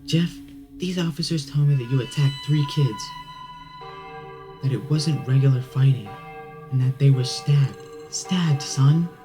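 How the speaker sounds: high, loud maternal scolding; stern, agitated parent; upset mother. Acted. Angry mother confronts her son.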